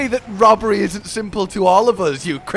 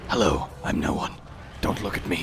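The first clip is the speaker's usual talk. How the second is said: low, hushed, trying to vanish; sneaky whisper; 7 dB quieter than usual. Pretend. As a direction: whisper like nobody sees you. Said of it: "so you wanna be like".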